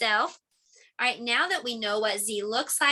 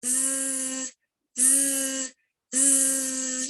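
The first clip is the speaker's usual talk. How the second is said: short soft buzzing z; buzzing z sound; 5 dB quieter, much slower than usual. Shown as a demonstration. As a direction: buzz through closed teeth. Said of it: "hut your teeth together".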